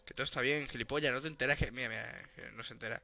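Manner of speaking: low, hushed grumble; muttered aside. Real, not acted. Player complaining that Javi doesn't get it, muttered aside. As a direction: grumble under the breath.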